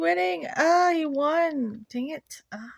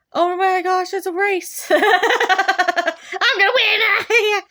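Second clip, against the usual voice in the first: excited laughing; 2 semitones higher, 7 dB louder than usual. Real, not acted. Excited race in game.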